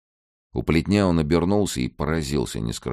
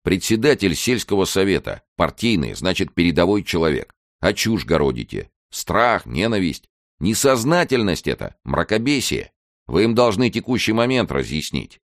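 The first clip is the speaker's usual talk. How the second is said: higher, emphatic ideological scolding; lecturing reproach; 6 semitones higher, 4 dB louder than usual. Acted. Lieutenant lecturing the village chairman, reproachful. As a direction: admonish like a keen young official.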